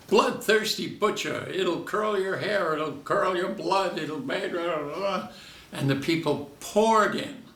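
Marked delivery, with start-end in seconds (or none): 0.1-5.3 movie ad pitch. Retold or imitated. Advertising the movie on Broadway, hammed-up high barker pitch. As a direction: sell the horror film like a barker. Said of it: "and advertise".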